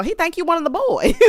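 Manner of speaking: high, amused voice breaking into laughter; real laugh bubbling through the words. Real, not acted. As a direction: crack up as you tease. Cracking up at the nickname.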